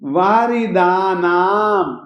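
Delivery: वारिदानाम slow, long आ held. Shown as a demonstration. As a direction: draw out the आ in वारिदानाम. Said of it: "आ को आ के ढंग से बोलेंगे".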